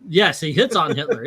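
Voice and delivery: usual speaking voice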